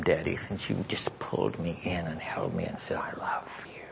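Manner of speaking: very soft, fading into a whisper; tender hush. Retold or imitated. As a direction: let a child's loving plea sink to a whisper. Voicing daughter's tender plea.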